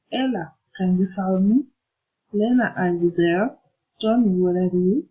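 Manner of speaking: name first, "Ella", then the question swinging up at the end; direct address intonation. Shown as a demonstration. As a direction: call "Lena" by name and lift "there". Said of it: "Direct out speaking intonation".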